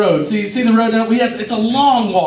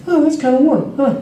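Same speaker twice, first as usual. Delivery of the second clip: higher, intrigued musing; curious surprise; 5 semitones higher than usual. Retold or imitated. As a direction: sound pleasantly intrigued. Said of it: "in my head".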